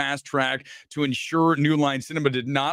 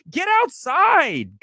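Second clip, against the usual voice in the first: slow, high, pushy scold at nerds; mock scolding; 13 semitones higher, 4 dB louder, slower than usual. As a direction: bark the order slowly like a fed-up parent. Scolding online fans.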